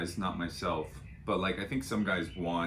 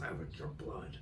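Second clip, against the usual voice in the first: mock whisper; 5 semitones lower, 10 dB quieter than usual. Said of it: "and she goes".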